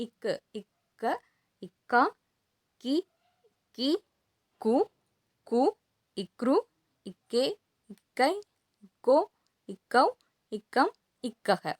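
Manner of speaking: syllables recited one by one with long pauses; pronunciation demo. Shown as a demonstration. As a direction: model each k-syllable separately, from இக்க through to இக்கக. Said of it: "சொல்லி காட்டுறேன்".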